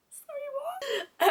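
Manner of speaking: very high, slow squeak of confusion; exaggerated bafflement. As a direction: stretch it out in a squeaky, mock-clueless voice. Repeats the baffled reply, exaggerated.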